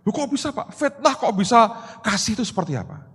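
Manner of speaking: high-pitched retold disbelief; startled. Slandered friend questioning pastor's odd comfort, startled. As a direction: imitate a baffled man questioning his pastor.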